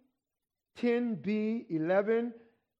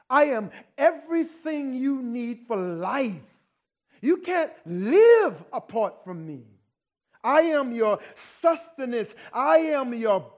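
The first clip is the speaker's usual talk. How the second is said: louder, preaching the Lord's words with force; voicing the Lord, rising; 4 semitones higher, 6 dB louder than usual. Preacher voicing the Lord to the congregation, forceful and loud. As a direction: proclaim it like a preacher at full force.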